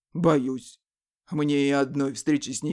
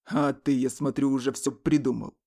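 smirking; 8 semitones lower than usual. Acted. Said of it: "ухмыльнулся Леший".

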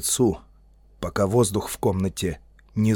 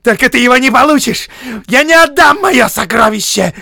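very high, loud screech; piercing, frenzied hobbit shout; hysterical yelling; 13 dB louder than usual. A narrator's reading. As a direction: scream it, nearly choking with rage. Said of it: "Чуть не зашёлся в крике Бильбо".

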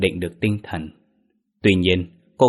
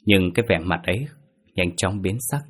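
ordinary delivery, nothing marked

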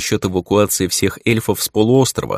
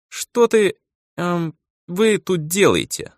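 put-on higher, slow frowning girl; displeased elf maiden, drawn out; young elf girl voice; 10 semitones higher, slower than usual.

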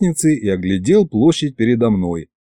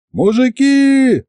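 high, slow bellow to the crowd; yelling; 13 semitones higher, 3 dB louder, much slower than usual. A narrator's reading. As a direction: call the whole square. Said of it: "заорал я".

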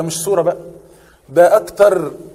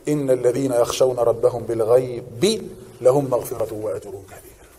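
slow, quiet recitation of the verse; Quran recitation; 7 semitones lower, 5 dB quieter, slower than usual. Shown as a demonstration. Teacher quoting a verse to the class, slow quiet recitation. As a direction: recite it softly and slowly.

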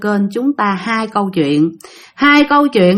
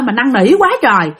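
normal talking voice

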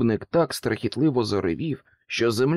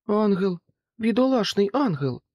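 ordinary delivery

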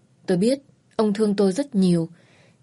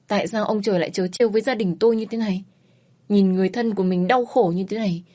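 usual speaking voice

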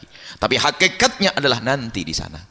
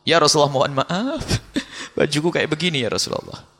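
higher, imploring plea for forgiveness; pleading apology to the Prophet; 7 semitones higher than usual. Pretend. A believer apologizing to the Prophet, pleading higher. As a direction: beg pardon like a guilty believer. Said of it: "sampaikan maaf Anda kepada Rasulullah".

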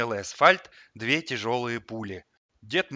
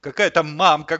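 loud, higher, bitter retort; bitter scoff; 4 semitones higher, 10 dB louder than usual. A narrator's reading. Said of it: "горько хмыкнул хомяк". (горько хмыкая)

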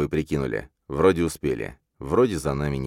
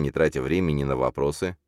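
usual speaking voice

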